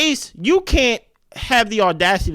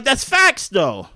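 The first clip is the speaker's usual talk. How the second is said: much higher, emphatic That's facts; excited agreement; 6 semitones higher, much slower than usual. Retold or imitated. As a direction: blurt it out, thrilled. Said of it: "And I'm like".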